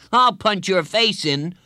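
high, loud schoolboy threat; heated playground threat; threatening boy. A narrator's reading. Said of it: "He shook his fist at her".

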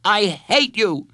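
put-on high, drawn-out child's shout; angry shout. A narrator's reading. Boy shouts at his counselor's face, furious. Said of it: "he shouted in her face".

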